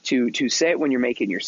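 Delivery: usual speaking voice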